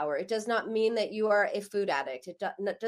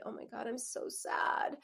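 soft, whispered demonstrated sadness; 7 dB quieter than usual. Shown as a demonstration. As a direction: breathe it out as a felt sadness. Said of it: "Some people will say".

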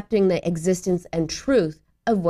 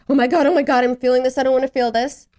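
higher, louder rush of mock panic; anxious; 4 semitones higher, 4 dB louder, faster than usual. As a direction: panic at your own feelings. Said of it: "do you get anxious?".

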